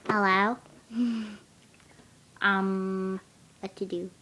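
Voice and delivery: talking on a lungful of helium. Real, not acted. Vlogger testing helium on herself for viewers, helium voice. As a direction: play with the helium-changed voice, amused. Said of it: "I wanna see if my voice sounds funny".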